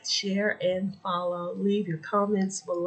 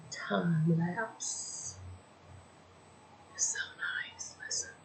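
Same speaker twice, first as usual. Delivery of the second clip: whisper; 12 dB quieter, slower than usual. Real, not acted. Whispers while filming scenery.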